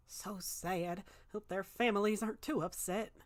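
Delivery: muttering. Acted.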